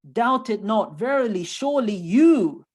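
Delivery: loud, insistent assurance; emphatic assurance. Acted. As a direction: drive each word home forcefully. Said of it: "a word for emphasis".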